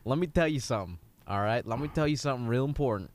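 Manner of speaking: put-on Southern accent. Old coach pep-talking a young player, lightly Southern. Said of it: "God, I went Southern".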